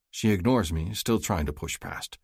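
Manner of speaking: ordinary delivery, nothing marked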